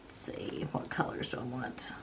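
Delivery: whispered to herself; whispering. Real, not acted. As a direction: mutter it under breath. Muttering to herself while choosing colors.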